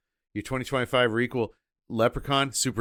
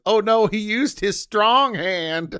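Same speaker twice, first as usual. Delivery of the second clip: slow, high mock alarm; mock dismay; 10 semitones higher, 8 dB louder, slower than usual. Cohost joking about the leprechaun's hand, slow mock alarm. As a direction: drag it out in fake horror. Joking reaction to movie gag.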